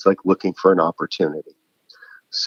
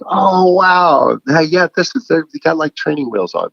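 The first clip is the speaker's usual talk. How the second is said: high, upbeat, gently encouraging; kind encouragement; 8 semitones higher, 3 dB louder than usual. Retold or imitated. Encouraging a new writer, high and upbeat. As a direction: cheer a beginner on kindly. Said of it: "just go like".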